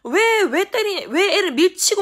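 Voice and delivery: high, loud, indignant shouting; retold mother berating a child; angry scolding. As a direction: fire the questions at full volume.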